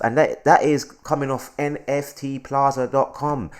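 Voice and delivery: normal talking voice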